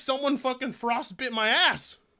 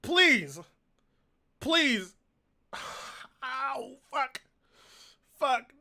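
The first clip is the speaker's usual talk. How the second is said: ordinary talk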